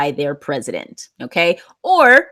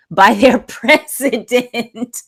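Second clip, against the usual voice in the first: laughing; 3 semitones higher, 4 dB louder, slower than usual. Real, not acted. Teacher amused reading student sentence.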